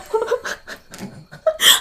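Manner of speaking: high breathy laugh through tears; choked laughing sob; teary laugh. Real, not acted. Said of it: "how I sound like crying".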